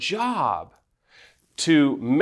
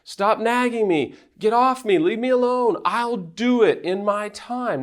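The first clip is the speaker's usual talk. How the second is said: much higher, exasperated mock protest; frustrated partner; 9 semitones higher than usual. Nagged partner pushing away the over-responsible spouse, high exasperated mock protest. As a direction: play the nagged partner snapping back. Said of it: "gets frustrated and says".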